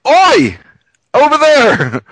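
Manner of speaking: high, loud street-vendor call; market shout. Retold or imitated. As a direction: yell across the market to catch attention. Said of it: "it's like".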